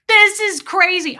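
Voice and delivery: retold outburst. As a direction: throw it at him mid-fight. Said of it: "I said that to him in our fights".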